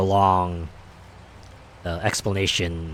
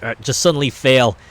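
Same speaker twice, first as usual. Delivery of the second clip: ordinary talk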